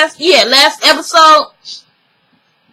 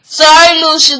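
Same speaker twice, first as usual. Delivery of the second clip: normal talking voice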